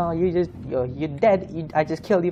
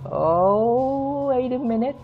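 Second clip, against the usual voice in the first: rising alarmed cry, higher; 8 semitones higher than usual. Real, not acted. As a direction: panic building at the zombie. Player spots a zombie.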